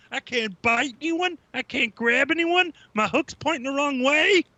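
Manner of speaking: whiny robot voice. Retold or imitated. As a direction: whine like a useless little bot. Voicing a toy robot mockingly.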